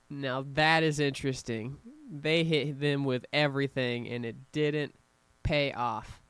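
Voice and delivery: ordinary talk, nothing marked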